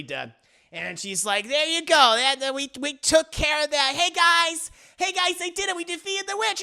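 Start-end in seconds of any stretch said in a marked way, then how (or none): 1.5-6.6 high, bright-eyed victory call; proud announcement. Retold or imitated. Acting Mommy telling the guys of victory, triumphant. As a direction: call out high to the group, pleased.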